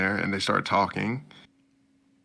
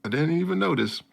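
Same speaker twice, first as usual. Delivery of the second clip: high, quick oblivious voice; hammed-up clueless friend; mock oblivious guy; 9 semitones higher, faster than usual. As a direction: answer cluelessly, pitched up. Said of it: "He's like".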